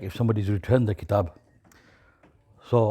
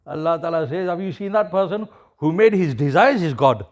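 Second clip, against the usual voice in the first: rising, fervent sermon voice, higher and louder; preaching; 5 semitones higher, 4 dB louder than usual. Acted. Preacher telling the brothers their life's purpose, fervent and raised. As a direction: lift the hall with each line about Allah. Preacher drives home the moral.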